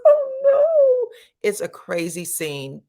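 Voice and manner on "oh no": high, loud mock wail; fake crying. Sheree faking tears for the camera, high wail. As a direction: ham up a fake sob. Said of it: "pretends that she goes".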